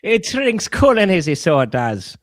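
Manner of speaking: Irish accent. Shown as a demonstration. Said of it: "I really am trying to sound Irish".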